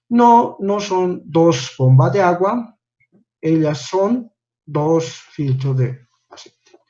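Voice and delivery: slow, with "No, no" lifted high; slow deliberate read. Shown as a demonstration. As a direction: spell out the wrong sentence slowly for the class. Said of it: "Entonces no tiene sentido". (lectura lenta y exagerada de la frase errónea)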